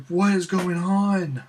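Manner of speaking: high, slow, drawn-out mock amazement; acted-out giddy disbelief; excited bewilderment. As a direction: stretch it out as if overwhelmed. Retelling his own bewildered excitement.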